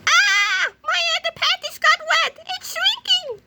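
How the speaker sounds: very high squeaky voice; high shrinking cartoon squeak. Nerd shrinks.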